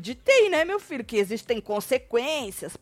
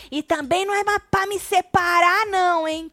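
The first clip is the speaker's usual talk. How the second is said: higher, louder retold yell; imitated shouting; 7 semitones higher, 3 dB louder than usual. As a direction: imitate him yelling from the background. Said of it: "gritando assim".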